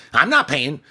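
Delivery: high, loud venomous outburst; hissing anger. Acted. Woman turning on the manager, furious that he refuses. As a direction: snap from sweet to furious in an instant. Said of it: "from cooing to hissing".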